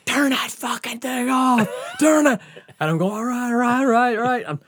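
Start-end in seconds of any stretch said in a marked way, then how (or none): 0.0-2.4 high-pitched mock yell of an angry cop; imitated yelling. Cop yelling at the mower rider, imitated yelling. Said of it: "he's just fucking yelling". 3.1-4.4 retold hasty compliance. Rider answering the yelling cop.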